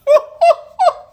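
loud, high burst of real laughter; incredulous laugh. Real, not acted. Laughs at the palace statement.

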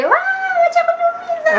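very high, slow mock wail; drawn-out squealing imitation; mock movie demand. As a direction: wail it out, imitating an exaggerated plea. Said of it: "No, I don't finish up near a movie and say".

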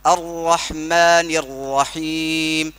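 अर्रहमानिर्रहीम drawn out very slowly, with the rr held; tajweed recitation. Shown as a demonstration. Teacher models the correct recitation for the student. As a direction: chant अर्रहमानिर्रहीम slowly, with lips flat. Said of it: "ऐसा".

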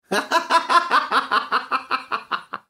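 high rattling cackle. Real, not acted. Cracking up at the Reverend's name, high staccato laughter. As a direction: burst into helpless, high laughter.

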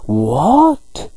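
drawn-out, rising indignant "What?"; mock-angry rising demand; angry disbelief. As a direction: let it climb sharply in outrage. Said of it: "And he gets really angry".

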